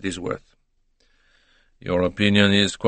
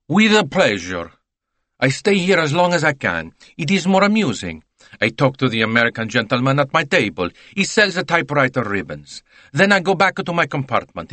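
put-on higher, lively Italian-accented voice, gossipy about the English; chatty Italian witness; 4 semitones higher than usual. Talkative Italian tells the detective his night, higher and lively. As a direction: play the talkative Italian salesman.

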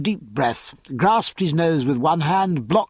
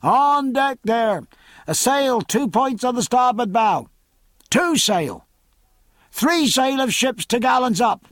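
shouting from crow's nest; 8 semitones higher than usual. Acted. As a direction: hail the deck from the masthead. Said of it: "cried the lookout".

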